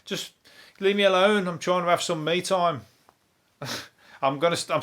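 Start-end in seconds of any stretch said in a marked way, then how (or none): 0.0-2.8 high, exasperated mock voice; fed-up Paul Chuckle. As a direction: squeak it out high, sick of the ghost. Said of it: "like, he's going".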